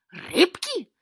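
loud, high outburst rising at the end; roared question. A narrator's reading. Grenka roars back at the cat's confession, incredulous. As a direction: roar it in outraged disbelief. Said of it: "рявкнула Гренка".

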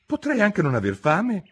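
put-on little girl thinking aloud; Alice. Alice voiced.

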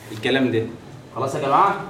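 ordinary delivery

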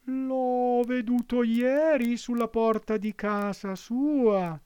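high, slow fox voice, sweetly cunning; the fox coaxing the puppet; sly fox. A narrator's reading. Fox answers Pinocchio.

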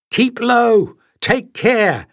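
ordinary delivery

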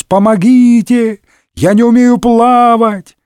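high, drawn-out wailing cry; bawling for help. A narrator's reading. Porthos cannot swim, bawling for rescue. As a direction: cry out slowly like someone drowning. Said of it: "благим матом орал бедный Портос".

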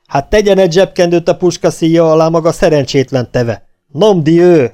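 loud, high, exasperated corporal's shout; yelling. A narrator's reading. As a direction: roar an order, building to a curse. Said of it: "Aztán ordított".

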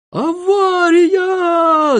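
long, high, drawn-out cry of alarm; alarmed shout. A narrator's reading. Passenger alerts everyone as balloon falls, shouting. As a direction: yell it high and stretch the vowels. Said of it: "закричал Сиропчик".

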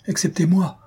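high, eager interjection; quick retort. A narrator's reading. Young girl insisting she has not forgotten him, quick retort. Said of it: "répliqua vivement Minella".

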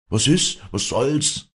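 high, loud startled questions; alarmed calling. A narrator's reading. Villagers torn from sleep call down, loud and startled.

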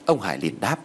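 ordinary delivery

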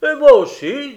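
loud, high-pitched chime-in; eager outburst. A narrator's reading. Tinker waking up to volunteer, eager and loud. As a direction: pipe up loudly, suddenly awake.

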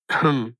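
low captain's "hm"; short low cough-like hem; throat clearing. A narrator's reading. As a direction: clear the throat before speaking. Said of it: "кашлянул капитан гарнизона".

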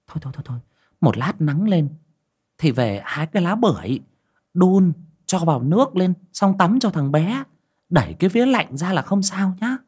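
put-on flustered older neighbor giving instructions; flustered urging. A narrator's reading. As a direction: shush and hurry them along with advice. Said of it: "Bà cuống cuồng giục người kia".

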